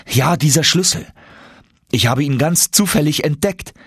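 proud, eager reveal; young nephew voice. Acted.

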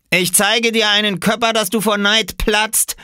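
high, loud comeback; boastful retort. A narrator's reading. Dared boy promising an amazing dive, loud boastful retort. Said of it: "gab Robert zurück".

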